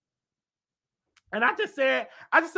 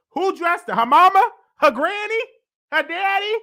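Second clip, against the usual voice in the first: high, loud disbelieving questions; mock outrage, voice climbing; incredulous; 9 semitones higher, 5 dB louder than usual. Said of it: "I'm like".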